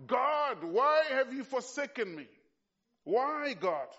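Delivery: loud, high anguished outcry to God; anguished cry. Acted. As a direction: cry it out full-throated, in agony. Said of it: "Mark says Jesus cried it out loud".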